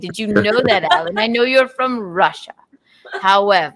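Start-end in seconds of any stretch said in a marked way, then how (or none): 0.0-2.5 mock English elocution; put-on English accent. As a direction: recite it in a theatrical English accent. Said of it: "You kinda sound like Tracy Ullman".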